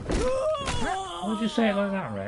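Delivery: high, slow put-on voice; mock scolding voice. Gamer scolding himself, mock high voice. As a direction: tease yourself in a silly voice.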